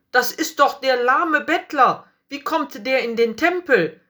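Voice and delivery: high-pitched amazement of a crowd; astonished exclamation. A narrator's reading. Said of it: "riefen sie". (erstaunt gerufen, mit hoher Stimme)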